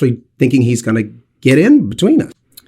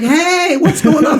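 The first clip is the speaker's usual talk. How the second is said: cheery greeting; 12 semitones higher, slower than usual. Retold or imitated. Greeting recognising fans.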